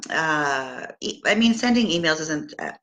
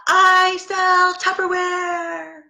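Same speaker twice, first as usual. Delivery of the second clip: high, drawn-out holler, every word stretched; top-of-lungs yell; 4 dB louder, much slower than usual. Acted. Saleswoman hollering to the street from her front step, full yell. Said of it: "yelling at the top of your lungs".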